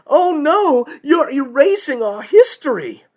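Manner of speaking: high, loud hammed-up whine; mock whining. Old guard protests Confederate statues coming down, in a mock whine. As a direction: wail it like an aggrieved old guard. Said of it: "all the old guard whined".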